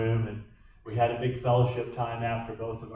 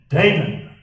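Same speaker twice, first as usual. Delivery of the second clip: DAMON loud, drawn out, a warning; mom voice; 4 semitones higher, 9 dB louder than usual. Shown as a demonstration. Preacher showing how mom warned her son, stern mom voice. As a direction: say DAMON as a mom's loud command. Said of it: "There's the mom voice".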